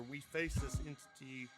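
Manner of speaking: ordinary talk, nothing marked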